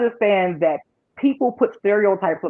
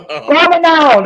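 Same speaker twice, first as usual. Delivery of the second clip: loud, higher mock Jamaican call; fake Jamaican accent; 8 semitones higher, 7 dB louder than usual. Shown as a demonstration. Showing off to the group, fake Jamaican accent. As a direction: belt it out like a TV psychic with a Jamaican accent. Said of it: "I mimic a fake Jamaican accent".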